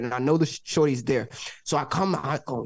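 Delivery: ordinary talk, nothing marked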